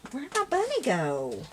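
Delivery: high, drawn-out plaintive cry; dismayed wail. Real, not acted. Crafter to herself, bunny gone missing, dismayed wail. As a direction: wail it high, falling away at the end.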